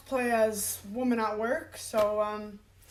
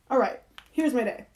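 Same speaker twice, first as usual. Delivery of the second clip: ordinary talk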